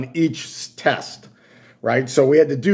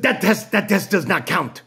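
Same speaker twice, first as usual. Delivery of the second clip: stern technician; 5 semitones higher than usual. Retold or imitated. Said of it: "So she said".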